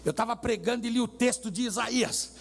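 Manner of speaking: normal talking voice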